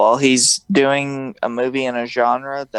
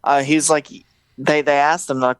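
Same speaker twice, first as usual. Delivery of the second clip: ordinary talk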